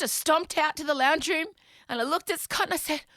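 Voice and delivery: normal talking voice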